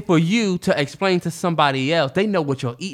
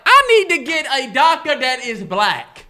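slow, higher, exasperated; deadpan punchline; 5 semitones higher, slower than usual. Retold or imitated. Ending his doctor story to Terrence, slow exasperated punchline. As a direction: drag the punchline out slowly.

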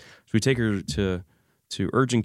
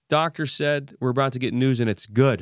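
higher, brighter read of the text; relieved misreading; 6 semitones higher, 3 dB louder than usual. Retold or imitated. Dad misreading his wife's text, lifted and relieved. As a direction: read it as if it's good news. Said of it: "I read".

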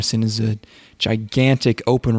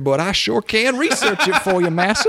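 mock servant voice; 7 semitones higher than usual. Joins the parody in a put-on voice.

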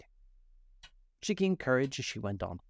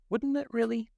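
put-on high, surprised little-girl question; Alice amazed, pitched well up; 14 semitones higher, 4 dB louder than usual. A narrator's reading. Alice surprised by the Mock Turtle, high and amazed. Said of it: "said Alice in a tone of great surprise".

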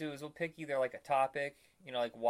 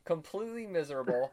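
normal talking voice

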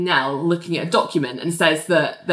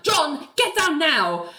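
high, slow imitated command; mock stern telling-off; really stern; 7 semitones higher, 3 dB quieter, slower than usual. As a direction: order it firmly, stretching each word. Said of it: "Nell is really stern".

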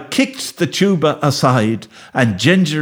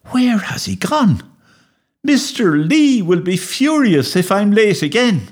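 worried fretting; 8 semitones higher than usual. Acted. Boy frets over runaway dog.

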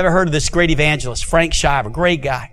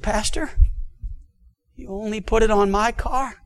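stunned hush; 2 semitones higher, 7 dB quieter than usual. Retold or imitated. Monica realizes, stunned.

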